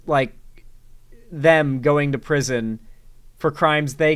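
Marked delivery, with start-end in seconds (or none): none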